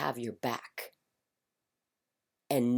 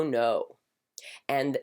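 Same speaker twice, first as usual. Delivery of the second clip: ordinary delivery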